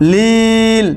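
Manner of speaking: "লিল" with a long held ই; drawn-out, level "লিল" at a stop; three-alif elongation. Shown as a demonstration. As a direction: stretch the vowel of "লিল" before closing on ল. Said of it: "ওয়াকফ করলে তিন আলিফ পরিমাণ টান".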